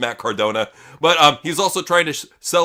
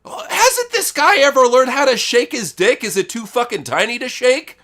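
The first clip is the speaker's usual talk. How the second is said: disgusted rant; 8 semitones higher, 3 dB louder than usual. Real, not acted. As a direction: spit it out in disbelief, high and loud. Ranting at a wrestler's gross habit.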